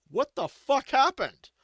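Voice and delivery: high, loud, slow mock outrage; shocked trader. Traders arriving at the burnt town, loud shocked outcry. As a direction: gasp it out like a stunned trader. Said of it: "the traders, like".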